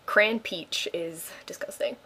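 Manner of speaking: slow whisper, no voice at all; whispered aside. Real, not acted. Whispering to viewers about the drink. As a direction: breathe it out under your breath.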